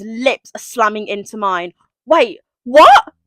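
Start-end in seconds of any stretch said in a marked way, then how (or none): 2.0-3.1 loud, high disbelief; shocked. Acted. Reader reacting to the sudden first kiss, shocked. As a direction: react as if blindsided.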